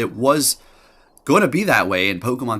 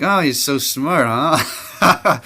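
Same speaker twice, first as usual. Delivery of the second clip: mock taunt; 5 semitones higher, 4 dB louder, much slower than usual. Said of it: "I say that sometimes".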